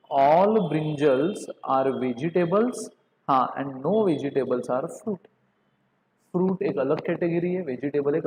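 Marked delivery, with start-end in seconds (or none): 0.1-5.3 each word drawn out slowly; very slow dictation. Shown as a demonstration. Teacher modelling the answer for students, very slow. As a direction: spell the statement out word by word. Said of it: "मतलब आप बोलोगे".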